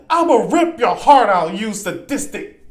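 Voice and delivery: high, mock demon threat; loud start, slowing into an insult; vulgar insulting demon. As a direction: imitate a demon hurling abuse. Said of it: "vulgar, insulting demon".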